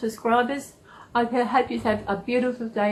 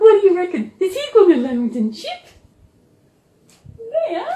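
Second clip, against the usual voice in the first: higher, louder pet-talk voice; 9 semitones higher, 6 dB louder than usual. Pretend. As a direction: ask the dog like a baby.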